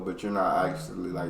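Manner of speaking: normal talking voice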